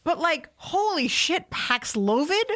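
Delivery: slow disbelieving drawl on Paxlovid; incredulous. Real, not acted. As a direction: stretch it out in disbelief. Disbelief at the name.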